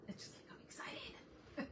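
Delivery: whispered sheepish aside. Real, not acted. Confessing to the chat why she shouts, in a whisper. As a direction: drop to a giggly whisper.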